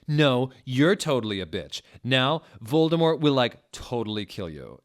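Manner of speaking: ordinary delivery, nothing marked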